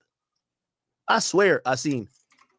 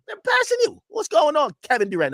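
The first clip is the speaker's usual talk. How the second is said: exasperated shout; 11 semitones higher than usual. Real, not acted. Streamer yelling at Kevin Durant.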